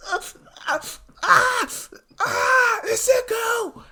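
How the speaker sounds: shrill high screams of pain; screaming. Acted. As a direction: scream out under the force field. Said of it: "the lady starts to scream".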